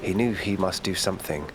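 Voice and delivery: ordinary delivery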